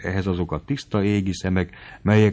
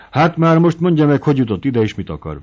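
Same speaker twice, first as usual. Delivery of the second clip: much higher, loud demand; imperious demand; 7 semitones higher, 7 dB louder than usual. Acted. Mari demands Noszti explain himself, high and loud. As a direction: demand the answer sharply.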